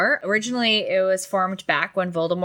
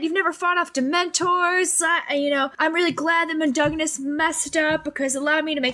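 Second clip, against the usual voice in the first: mock screaming rant; 8 semitones higher than usual. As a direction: rant like a bratty teen, pitched up. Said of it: "He starts screaming at them".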